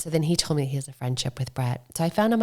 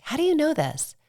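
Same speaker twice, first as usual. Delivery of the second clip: startled question; 12 semitones higher than usual. Retold or imitated. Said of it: "I'm like".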